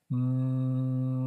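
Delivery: MOP stretched slowly, long hummed m and long ah; turtle talk. Shown as a demonstration. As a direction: say MOP as one slow unbroken stretch. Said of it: "we call this very slow, elongated talk turtle talk".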